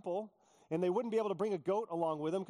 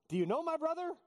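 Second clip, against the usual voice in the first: incredulous joke; 10 semitones higher than usual. Pretend. Joking about his brother.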